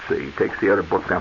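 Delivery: usual speaking voice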